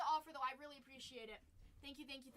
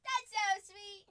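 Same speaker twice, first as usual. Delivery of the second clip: louder, higher affectionate squeal; gushing; 6 semitones higher, 11 dB louder, slower than usual. Real, not acted. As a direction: gush loudly over the dog. Coos again, louder.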